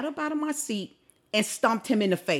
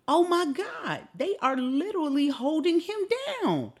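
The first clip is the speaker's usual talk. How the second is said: high-pitched, appalled reaction; retold disbelief; 8 semitones higher than usual. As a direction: replay your disbelief at what you're watching. Retold reaction to watching the video.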